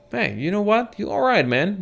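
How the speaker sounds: tipsy, slurring a little; sway and slur a bit; slightly drunk. Acted. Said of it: "in a slightly drunk voice".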